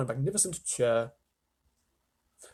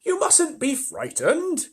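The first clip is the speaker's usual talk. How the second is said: very high, slow, gentle ladybird; kindly ladybird; 13 semitones higher, 4 dB louder, slower than usual. Acted. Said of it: "the ladybird said kindly".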